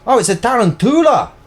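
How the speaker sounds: high, loud Irish exclamation; Irish accent. Shown as a demonstration. Sharing a spelling trick for tarantula, loud Irish accent. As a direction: exclaim it in a put-on Irish lilt. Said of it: "say it in an Irish accent".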